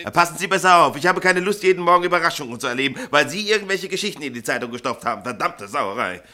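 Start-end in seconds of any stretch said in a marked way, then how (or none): 0.0-5.1 laut und deutlich höher, schimpfend; scharfe Zurechtweisung. Acted. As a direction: den Drucker zusammenstauchen. Chef schimpft mit dem Drucker. (laute, höhere Standpauke)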